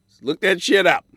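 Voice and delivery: loud fed-up rant; exasperated shout. Real, not acted. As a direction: bark it at the audience. Ranting about venue address.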